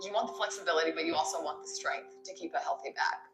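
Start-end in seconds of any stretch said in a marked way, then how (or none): none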